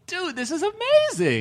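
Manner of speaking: high-pitched awestruck exclamation; amazed reaction. Retold or imitated. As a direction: exclaim it high, stretching the key word. Acting out own amazed reaction.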